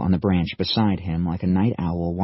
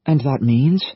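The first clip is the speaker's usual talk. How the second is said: urgent question; 6 semitones higher, 5 dB louder than usual. Acted. Liam presses anxiously.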